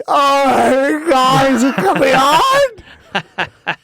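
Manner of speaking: mock wail. Mocking a producer crying over Norm, loud mock wail. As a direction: ham up a weepy producer's dread.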